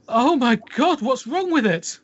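high shrieking alarm; panicked scream. Acted. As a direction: recoil in horror at the crib. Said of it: "screams and says".

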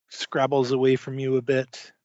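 ordinary talk

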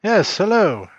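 calling loudly through a closed door; shouting through a door. Acted. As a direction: yell it through the door from the kitchen. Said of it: "Are you just shouting through the door?".

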